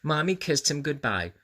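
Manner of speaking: ordinary delivery, nothing marked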